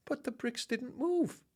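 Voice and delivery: ordinary delivery, nothing marked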